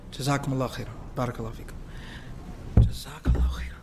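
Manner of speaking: very soft, low thanks; hushed, private word of gratitude; quiet aside. Real, not acted. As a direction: murmur thanks off to the side.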